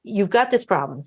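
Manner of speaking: ordinary talk, nothing marked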